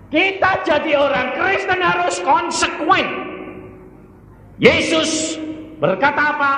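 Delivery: ringing challenge to the congregation; forceful exhortation. Real, not acted. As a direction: drive it home at full pitch. Preacher exhorting at full force.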